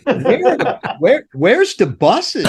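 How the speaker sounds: high, incredulous, slowing at the end; baffled outburst. Retold or imitated. Roadie waking to find no buses, high disbelief. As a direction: disbelief as the buses are gone. Retold confusion at missing buses.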